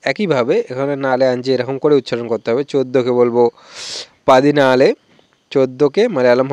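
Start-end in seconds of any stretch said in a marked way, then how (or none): none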